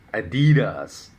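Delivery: Adidas with stress on the middle syllable; common American pronunciation. Shown as a demonstration. People defending their usage, Adidas said as uh-DEE-das. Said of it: "what's wrong with those pronunciations".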